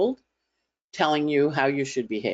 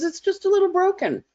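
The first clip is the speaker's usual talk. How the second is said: much higher, lilting mock-sweet; friend's quip; 3 dB louder than usual. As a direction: chirp it up high, gently teasing. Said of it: "and she goes".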